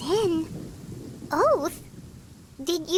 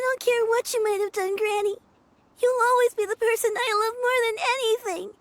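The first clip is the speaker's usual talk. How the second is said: tearful reassurance. Acted. Granddaughter comforts grieving grandmother.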